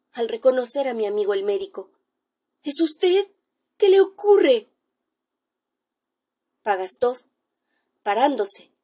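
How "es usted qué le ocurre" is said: alarmed question. Acted. Alarmed narrator meets fleeing friend.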